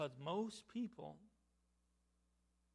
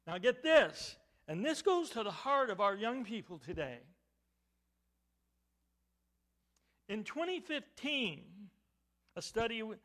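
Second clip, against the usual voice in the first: raised, emphatic preaching that builds to the figure; forceful preaching; 6 semitones higher, 9 dB louder than usual. Real, not acted. Preacher telling the congregation about young people's beliefs, forceful preaching. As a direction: demand the room's attention.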